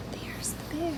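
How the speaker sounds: breathy hushed aside; whisper. Acted. Storyteller whispering to children where the bear is. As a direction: whisper it like a secret to the kids.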